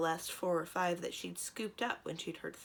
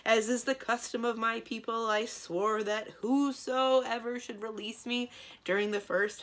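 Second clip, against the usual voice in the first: loud, higher otherworldly giant voice; booming djinn storyteller; 4 semitones higher, 7 dB louder than usual. A narrator's reading. Djinn tells the pair his tale, booming. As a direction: recite his ancient oath grandly.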